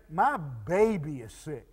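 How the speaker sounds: low, soft worried parent; quietly stepped-up parental worry; heartfelt concern. Retold or imitated. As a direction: drop to a tender, grave hush. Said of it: "we've stepped it up a notch".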